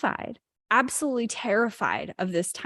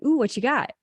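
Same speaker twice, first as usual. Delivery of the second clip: curious; 4 semitones higher than usual. Retold or imitated. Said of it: "I came from a place of curiosity".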